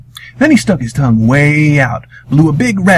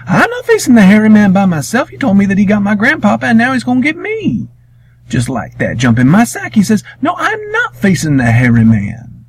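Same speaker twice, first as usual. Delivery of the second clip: put-on high, protesting boy's voice; young Wiley voice; 10 semitones higher than usual. Wiley refusing to face the monster, high and anxious. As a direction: pitch up for a scared refusing boy.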